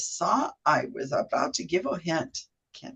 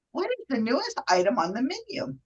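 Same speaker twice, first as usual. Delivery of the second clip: lightly put-on higher customer voice; a higher, lilting customer asking innocently; customer's voice; 4 semitones higher, 4 dB quieter than usual. Host playing customer asking about the menu, lightly put-on higher voice. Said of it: "I'm using two different voices".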